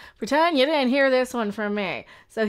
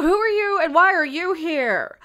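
mock interrogation; 6 semitones higher, 4 dB louder than usual. Streamer mock-interrogates the comedian.

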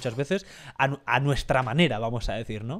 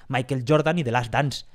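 put-on English names in a plain Spanish accent; everyday Spanish-accented English; 3 semitones lower than usual. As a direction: say the names with Spanish vowels and sounds. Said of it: "pronunciarlo como lo pronunciamos todos".

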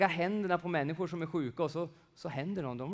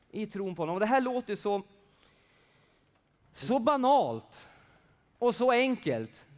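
high, loud emphatic preaching; 8 semitones higher, 7 dB louder than usual. Real, not acted. Preacher stressing simple faith in Jesus, higher and louder. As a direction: build with urgency.

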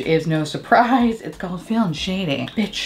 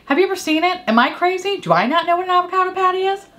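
exasperated; 10 semitones higher, faster than usual. Real, not acted. Exasperated questions to viewers.

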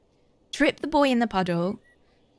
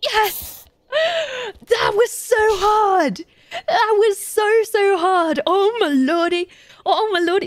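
much higher, louder, drawn-out cheering; elated shouts; 10 semitones higher, 5 dB louder, slower than usual. Real, not acted. The gamer finally beats the tricky task, elated shout. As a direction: shout with relief and joy.

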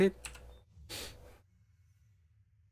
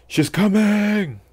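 high, drawn-out alarmed warning; 11 semitones higher, 5 dB louder, slower than usual. Real, not acted. Warning about enemy approach.

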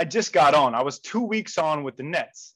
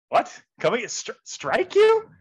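acted-out stunned question; baffled disbelief; 6 semitones higher, 4 dB louder, slower than usual. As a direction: pitch leaping up on the question. Baffled reply to strange order.

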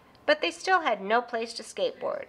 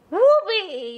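high, loud whoop of joy; excited yell; 9 semitones higher, 8 dB louder than usual. Acted. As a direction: shout it flying off the ramp. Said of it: "yelled Brother".

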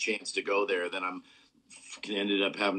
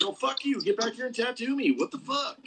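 mock angry clients; 13 semitones higher than usual. Host imitating impatient clients with a high-pitched mock outrage. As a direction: squeal like a pushy client demanding a tattoo. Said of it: "They're like".

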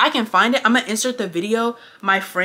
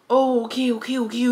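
higher, mock-soothing murmur of okays; lightly hammed-up placating; mock catering; 4 semitones higher than usual. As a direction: imitate someone fussing over a supposed victim. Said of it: "he starts catering to".

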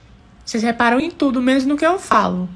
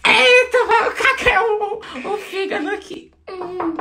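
laughing; 9 semitones higher, much slower than usual. Real, not acted. Laughing at the toilet.

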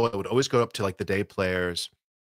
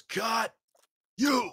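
mock director yell; 13 semitones higher than usual. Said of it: "the director goes".

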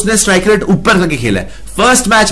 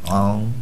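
low, soft, drawn-out chewing grunt; imitating a sluggish gum chewer; mock slow chewing sound; 10 semitones lower, 9 dB quieter, much slower than usual. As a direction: chew it lazily and deep. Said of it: "ये चबा रहा धीरे धीरे".

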